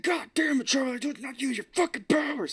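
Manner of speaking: high mock yell at a kid; imitating a yell. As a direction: play the dad losing it at his kid in a hammed-up shout. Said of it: "yelling at this kid".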